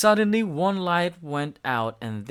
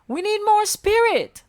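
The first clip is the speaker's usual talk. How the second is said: much higher, louder, leaning on more; Bella voice; 7 dB louder than usual. A narrator's reading. Bella rallying everyone, high and loud. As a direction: urge the others on. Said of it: "Bella said".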